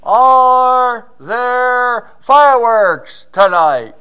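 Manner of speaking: slow, long, loud call across the water; yelled out. Retold or imitated. On a dark lake, yelling to shore across the water. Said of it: "I just yelled out".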